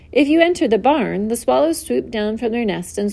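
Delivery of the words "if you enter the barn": ordinary delivery, nothing marked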